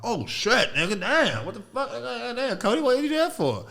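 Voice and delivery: put-on squeaky high five-year-old shock; high-pitched childish outrage; high whiny kid voice. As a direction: yelp it like a little kid who lost a tooth. Kid self reacting, high squeak.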